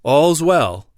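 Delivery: a moderate narrated shout; sentry's shout. A narrator's reading. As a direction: call it out like a soldier on guard. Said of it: "over the hole and shout".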